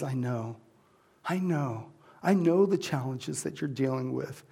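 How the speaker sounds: very soft, low reassurance; God whispering I know; tender God voice. Acted. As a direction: comfort a grieving child softly. God voiced comforting softly.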